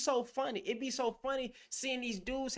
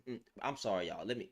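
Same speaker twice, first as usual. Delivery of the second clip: usual speaking voice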